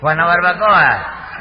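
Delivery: Juana Barbacoa, slow and loud, each word split apart; spelled-out mangled name. Acted. Suspect insists the town is called Juana Barbacoa. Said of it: "¿Y dónde queda Guanabacoa".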